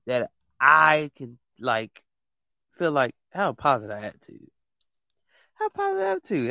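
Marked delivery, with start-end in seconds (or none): 5.5-6.5 squeaky plea. Real, not acted. Host pleading with guests.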